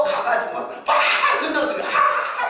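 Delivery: ordinary talk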